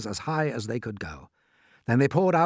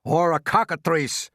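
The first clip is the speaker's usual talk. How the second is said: loud, slow, raised yell; 6 semitones higher, 5 dB louder, slower than usual. A narrator's reading. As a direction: yell a rival guess, drawn out. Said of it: "yelled the chief of police".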